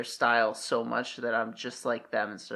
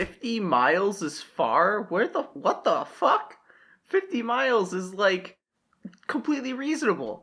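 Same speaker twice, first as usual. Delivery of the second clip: high, loud disbelief; incredulous; 7 semitones higher, 3 dB louder than usual. Real, not acted. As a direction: scoff at how absurd it is. Disbelief at "fifty miles is far.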